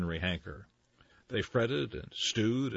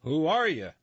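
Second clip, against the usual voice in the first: louder demanding question; mayor demanding of stranger; 7 semitones higher, 6 dB louder than usual. Acted. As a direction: insist on an answer. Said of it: "demanded the mayor".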